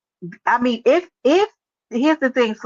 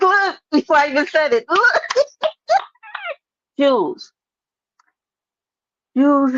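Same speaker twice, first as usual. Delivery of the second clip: laughing, louder and higher; 7 semitones higher, 4 dB louder than usual. Real, not acted. Host laughing at Jules, who trolled her before she asked. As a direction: crack up as you call it out.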